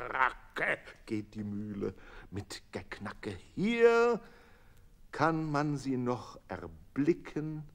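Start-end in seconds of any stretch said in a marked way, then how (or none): none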